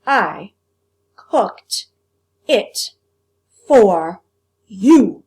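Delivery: each word punched loud with pauses. Acted. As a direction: hammer every word separately. Cook spells out each word.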